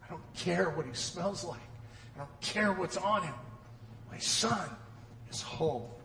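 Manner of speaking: soft, low loving father; a father holding his son close; hushed and tender, father's whisper of love. Acted.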